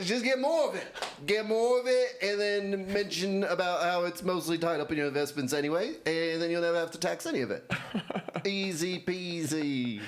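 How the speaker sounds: Elon Musk as a South African; South African accent. Acted. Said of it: "South African advice".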